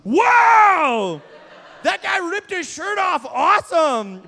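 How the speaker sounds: high, loud delighted God voice; excited God. Retold or imitated. God watching from heaven, loudly delighted. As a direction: play God thrilled by the shirt-ripper. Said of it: "God in heaven looking down like".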